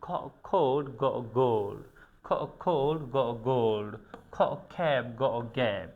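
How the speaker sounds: খোল্ড, গোল্ড, খ্যাপ, গ্যাপ each led by খ or গ; teacher modelling খ্যাপ and গ্যাপ; phoneme drill. Shown as a demonstration. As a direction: repeat খ খোল্ড গ গোল্ড carefully. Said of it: "বলতে হবে খোল্ড".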